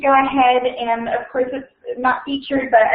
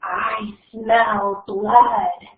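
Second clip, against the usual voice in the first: very slow, drawn-out menace; cold murderous voice; much slower than usual. Acted. The monster in the walls, very slow and menacing. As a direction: stretch each word like a hungry monster. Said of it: "he heard the voice".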